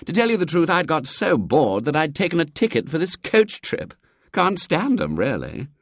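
lightly voiced bored young man. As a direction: toss it off like a bored young toff.

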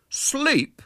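put-on high, incredulous boyish disgust; scandalised schoolboy; disgusted echo. A narrator's reading. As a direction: throw the word back in disgust. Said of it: "echoed William in disgust".

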